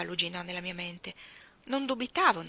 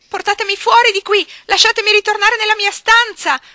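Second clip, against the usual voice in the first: screaming, much higher and louder; desperate shout; 18 dB louder than usual. Acted. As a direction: beg at the top of her lungs to get out. (grido disperato, altissimo)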